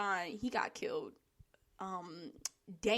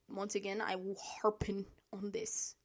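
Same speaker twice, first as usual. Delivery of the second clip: whispered aside; 3 semitones lower, 9 dB quieter than usual. Real, not acted. Weary aside before election rant.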